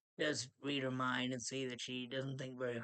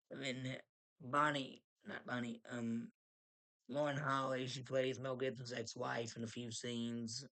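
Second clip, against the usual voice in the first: usual speaking voice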